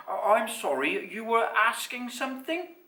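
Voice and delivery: high, courteous enquiry; polite mother. A narrator's reading. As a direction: politely invite a question. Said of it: "she turned to Basil".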